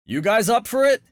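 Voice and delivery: high, shouted dare; daring challenge. A narrator's reading. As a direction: egg them on. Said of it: "Aiden asked Teddy".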